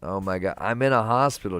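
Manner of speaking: ordinary talk, nothing marked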